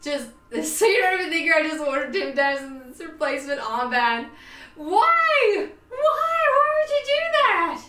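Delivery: normal talking voice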